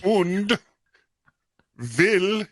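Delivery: WILL said with a V, as "vill"; bad German accent with V for W. Shown as a demonstration. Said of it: "you put a V in instead of a W".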